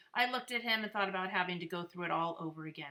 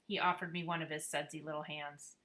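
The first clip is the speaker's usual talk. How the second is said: plain reading voice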